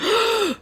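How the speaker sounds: mock gasp. Old people shocked by shirt.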